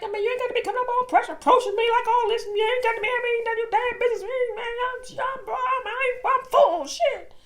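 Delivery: loud, high, fast offended rant; angry rant. Retold or imitated. Date defending his bad breath, loud fast angry rant. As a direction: fire it back at her, fast and loud. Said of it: "he was pissed off".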